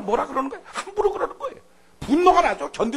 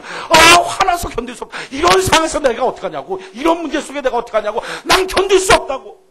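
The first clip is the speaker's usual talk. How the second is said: loud, high, frustrated wailing; exasperated outcry; 12 semitones higher, 13 dB louder than usual. Acted. Preacher voicing overwhelmed believers, loud and exasperated. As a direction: cry out as if you can't take it. Acted complaint of overwhelmed people.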